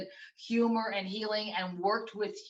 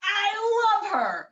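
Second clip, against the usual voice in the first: much higher, louder, slow, gushing; delighted burst; 14 semitones higher, 8 dB louder, much slower than usual. Real, not acted. Hearing the mom's surgery joke, a delighted gushing cry. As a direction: swoon over the mother with real delight.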